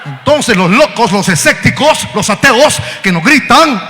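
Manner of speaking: loud, high preacher's shout; preaching at full force. Acted. Preacher warning the church, full-force preaching. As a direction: build to a cry. Sermon build.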